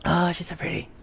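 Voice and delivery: whispering. Real, not acted. Cooing over pretty character.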